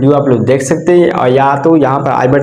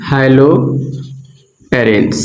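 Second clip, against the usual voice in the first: haloarenes stretched very slowly and lower; drawn out; 4 semitones lower, much slower than usual. Shown as a demonstration. Teacher to students answering her own question, slow drawn-out word. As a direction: draw out haloarenes syllable by syllable for the class. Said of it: "क्या बोलेंगे?".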